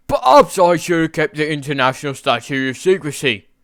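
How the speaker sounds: put-on English-accented grumble, loud at first and fading. England regretting lost secrecy to himself, English accent.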